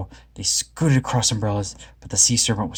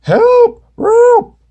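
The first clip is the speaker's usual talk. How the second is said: very high, loud cry; crying for help; 11 dB louder than usual. A narrator's reading. Shaggy and Scooby screaming on the serpent, very high and loud. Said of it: "they cried".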